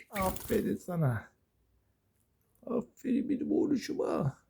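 high-pitched cooing at a pet. Real, not acted. Owner praising a pet boy, high baby talk.

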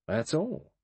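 grinning, lightly amused and evasive; with a grin. A narrator's reading. Lombard brushing off Blore's questions, grinning. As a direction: let a smile be heard on it. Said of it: "Lombard said with a grin".

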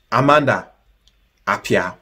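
normal talking voice

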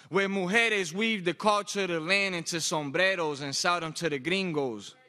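proud Spanglish verse, punched out rhythmically; impassioned spoken word. Acted. Poet performing to a responding crowd, impassioned spoken word. Performs second, louder piece.

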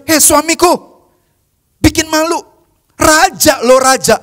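a hint of an embarrassed wife scolding, slow and drawn out; mock-scold him for shaming the crown. Wife scolding dancing King David, slow mock reproach. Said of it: "Dia bilang".

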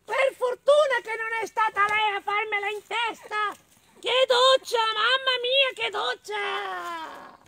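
very high squeaky mole; little mole. Acted. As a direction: wail the last words in a drawn-out squeal. Mole reacts to the cow pat.

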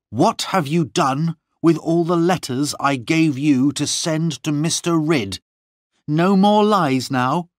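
high, forceful accusing questioning; angry demand. A narrator's reading. Lorna questioning Gwenny about the letters, angry demand. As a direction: confront a servant who has lied.

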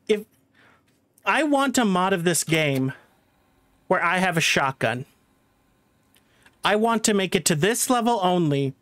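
usual speaking voice

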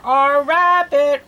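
usual speaking voice

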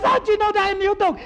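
high, fast, baffled question; incredulous challenge. Acted. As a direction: demand to know, pitched right up. Manager challenged by owner's certainty.

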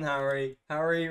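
ordinary delivery

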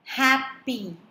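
"Happy" split as hap, py; syllable by syllable. Shown as a demonstration. Teacher dividing the word, "Happy" in two beats. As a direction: gap between the halves of "Happy". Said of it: "it is divided into two syllables".

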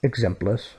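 "exemples" said the Catalan way, ending in -es; Catalan pronunciation. Shown as a demonstration. Teacher says the Catalan word for examples. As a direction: give "exemples" its Catalan vowels. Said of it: "as they're called in Catalan".

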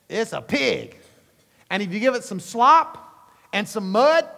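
loud, high, driving sermon delivery; full-force preaching. Acted. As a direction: preach it hard to the congregation.

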